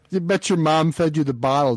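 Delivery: usual speaking voice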